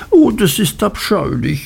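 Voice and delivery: put-on high-pitched young woman's protest; dismayed Dora. A narrator's reading. Dora rejects her suitor's earnest advice, dismayed. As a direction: exclaim in dismay, pitch leaping up.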